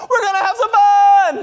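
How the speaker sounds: put-on loud, high kid's squeal; excited children. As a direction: yell it like a thrilled child. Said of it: "the sound of them excited I'm home".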